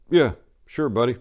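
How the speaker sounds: casual cocky reply. Acted. Tennessee answers groundskeeper.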